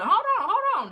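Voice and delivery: high, loud hold on; urgent self-interruption. Real, not acted. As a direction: stop the listener short. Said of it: "interrupting herself".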